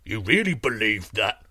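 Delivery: giant's voice lifting in puzzlement; perplexed giant. A narrator's reading. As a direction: ask it as a baffled giant.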